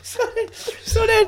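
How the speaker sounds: high voice choked by laughs; crying with laughter. Real, not acted. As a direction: try to continue through helpless laughter. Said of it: "I am crying".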